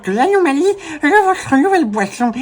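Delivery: very high, slow nerdy professor; squeaky, slobbering professor voice; Professeur Catastrophe voice. Acted. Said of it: "une voix un peu différente au professeur Catastrophe".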